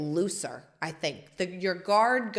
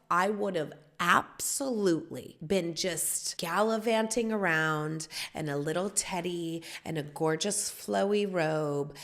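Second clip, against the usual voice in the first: ordinary talk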